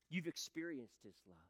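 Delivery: ordinary talk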